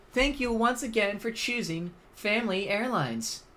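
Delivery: high, polished PA announcement; airport announcer. Pretend. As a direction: page passengers over the gate speaker.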